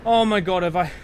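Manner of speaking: real fear spilling out; frightened near tears. Real, not acted. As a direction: shaken outburst after nearly dying. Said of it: "I'm gonna cry".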